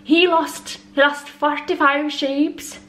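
lilting attempt at an Irish accent; Irish accent impression. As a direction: imitate an Irish news narrator. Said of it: "like, the narrator says".

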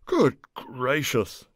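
slow, loud exasperated huff; fuming. Acted. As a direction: fume it out slowly. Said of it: "fumed Egbert".

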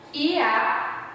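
ordinary talk, nothing marked

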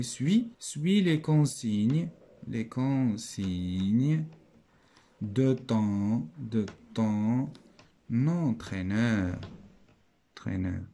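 very slow, stretched syllables with pauses; long drawn-out words, broken into chunks; slow dictation. Shown as a demonstration. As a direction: dictate it piece by piece while writing. Teacher dictating while writing the sentence.

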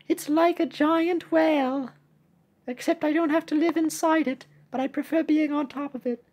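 high-pitched cartoon character voice; chatty little cookie. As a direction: chatter in a high, youthful squeak.

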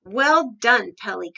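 loud storybook praise, dropping on the name; crying out. A narrator's reading. Giraffe congratulates Pelly, crying out. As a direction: cheer the first words out loudly. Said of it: "cried the giraffe".

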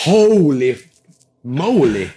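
drawn-out exclamation of disbelief; comic awe, each word on its own; mock stunned. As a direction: play up being overwhelmed. Acting his own shock at being picked.

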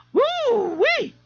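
very high, drawn-out whoop; amazed whoop. A narrator's reading. A young man reacts across the room, whooping in amazement.

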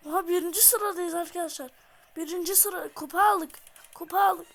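loud, thrilled yelling; excited shout. Real, not acted. Gamer yelling in joy over winning the cup. Celebrating first place and cup.

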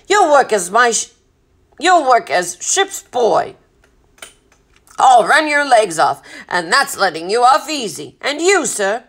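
forceful sea captain's orders; gruff bossy captain. A narrator's reading. Captain assigning a stowaway as ship's boy, forceful and bossy. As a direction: lay down the law loudly.

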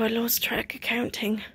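whispered sheepish aside. Real, not acted. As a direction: confess it under your breath. Hushed embarrassed aside.

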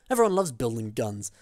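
ordinary delivery

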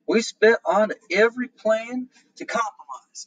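slow quoted Southern leader. Retold or imitated. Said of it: "explained one of the Southern leaders".

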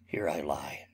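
a faint, failing whisper; dying whisper. Acted. Wounded Laertes to Hamlet, fading whisper. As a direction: barely breathe it out, life slipping away.

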